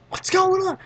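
high, loud startled question; mock alarm. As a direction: imitate bystanders calling out in confusion. Others alarmed by all the screaming.

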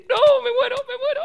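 high, breathless laughing cry; laughing fit. Real, not acted. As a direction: laugh till you can't breathe. Laughing fit, can't stop.

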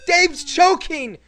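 high, slow panicked yelling; panicked shout. Real, not acted. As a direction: cry out in alarm, drawn out. Character dies in game.

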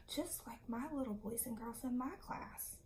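ordinary talk

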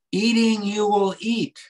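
"Eating" lifted high and loud, then "you will eat" rolled out in rhythm; rhythmic emphasis. Shown as a demonstration. Translator reading the Hebrew doubling aloud, rhythmic stress. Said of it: "I feel the emphasis there".

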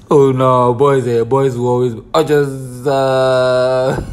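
normal talking voice

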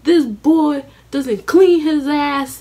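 slow, high, loud disgusted mom; scolding mother. Retold or imitated. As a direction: spit out the insult slowly. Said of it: "Then she goes".

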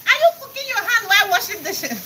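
high, shouting husband voice, scolding; mock shocked husband. Husband catching her washing dishes, shouted high disbelief. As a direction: squeal it out in disbelief like an appalled husband. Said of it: "It goes like".

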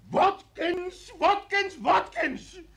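high, loud, desperate yelling; furious shout. Acted. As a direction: scream the name at him.

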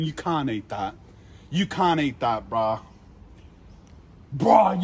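ordinary delivery

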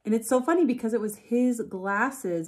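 ordinary talk, nothing marked

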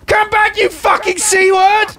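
high yell after a runaway; high, drawn-out shout; taunting shout. Real, not acted. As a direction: call the coward back, pitched high. Shouting after fleeing enemy.

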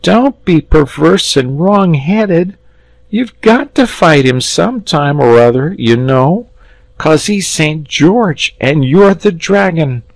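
put-on high boy's voice, urging; a lad coaxing his friend; pleading boy.

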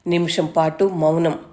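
usual speaking voice

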